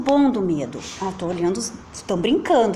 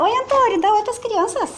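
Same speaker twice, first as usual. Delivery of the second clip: usual speaking voice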